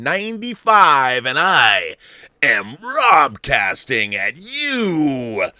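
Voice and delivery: loud, drawn-out announcer catchphrase; big booming host call; booming show intro. Real, not acted. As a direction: belt out the show intro, stretching the words.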